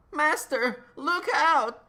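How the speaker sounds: high, loud elf cry of warning; Dobby shouting a warning. Acted. Dobby warns his master, high loud cry. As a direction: squeal it out to save your master.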